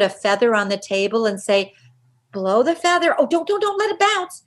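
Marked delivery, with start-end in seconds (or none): 2.3-4.4 high, playful warning to a little one; put-on high voice for instructing kids; coaching a child. Adult telling a child to blow a feather, high and playful.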